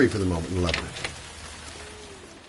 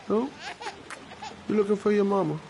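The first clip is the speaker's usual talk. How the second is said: put-on baby talk; 12 semitones higher than usual. Talking to a baby.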